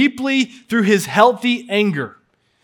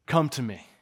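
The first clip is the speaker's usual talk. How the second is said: soft invitation; 5 semitones lower, 8 dB quieter than usual. Acted. Jesus' invitation quoted softly.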